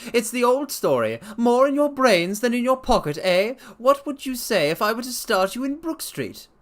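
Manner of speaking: bustle him along, high and loud; bustling. A narrator's reading. Blessington hurrying the doctor, bustling high voice. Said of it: "in his bustling way".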